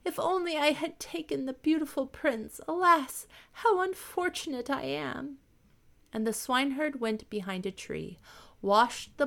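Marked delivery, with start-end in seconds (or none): 0.0-5.4 much higher, wailing lament; mock sobbing princess in the rain. A narrator's reading. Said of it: "sobbed the princess".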